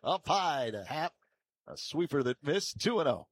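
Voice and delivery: quick, high, projected delivery call easing down; live play-by-play. Real, not acted. Announcer calling a missed sweeper, live play-by-play. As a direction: announce the pitch, then report the result.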